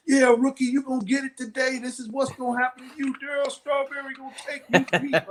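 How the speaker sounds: high, loud jeering rookie taunt; mock the new kid from over the fence; heckling fans. Mets fans heckling the rookie pitcher, high jeering taunt.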